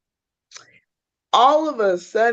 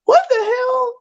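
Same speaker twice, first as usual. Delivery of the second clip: mock outrage. Friend outraged in story.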